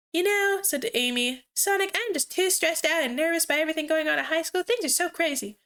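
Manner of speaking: put-on high-pitched girly voice; girl voice. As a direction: lift it high like Amy. Amy voiced in story.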